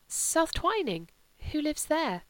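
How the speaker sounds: puzzled question. A narrator's reading.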